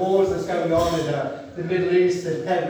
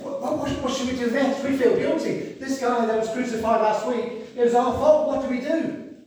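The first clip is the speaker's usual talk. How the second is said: higher, panicked crowd voice; anxious crowd; 7 semitones higher than usual. Retold or imitated. Crowd asking Peter what to do, higher and anxious. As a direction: voice the guilty crowd's alarm. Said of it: "they were saying".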